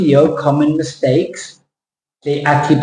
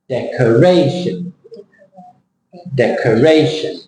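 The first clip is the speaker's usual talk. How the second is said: "Decoration" as dec-o-RAY-shun; careful dec-uh-RAY-shun, last syllable weak; stressed vowel. Shown as a demonstration. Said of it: "where is the sound?".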